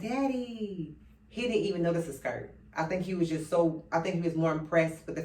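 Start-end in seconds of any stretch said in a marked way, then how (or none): 0.0-1.0 soft surprised Daddy. Retold or imitated. Surprised girl sees dad.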